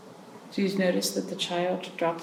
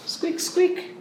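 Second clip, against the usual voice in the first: high breathy "Squeak, squeak"; toy squeak; 11 semitones higher than usual. Acted. Making the ball's noise for the children, high squeaks.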